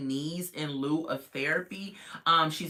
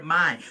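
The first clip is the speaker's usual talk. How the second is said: my punched louder; stress on my; 6 dB louder than usual. Shown as a demonstration. Vlogger repeating the key word, louder my. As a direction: hit the word my. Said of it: "That is the key word".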